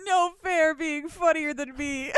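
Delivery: high, wheezy laughing complaint; cracked up, voice squeezed high; helpless laughter. Real, not acted. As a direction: whine it through real laughter. Cracking up at co-host's joke.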